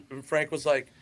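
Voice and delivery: usual speaking voice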